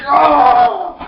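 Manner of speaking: high, loud shout; mock battle cry. Swordsman kills glasses guy, mock battle cry. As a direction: let out a hammed-up attack yell.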